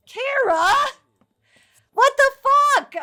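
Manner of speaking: a startled scream at the screen; outraged shock. Real, not acted. Sim ruins romance, outraged.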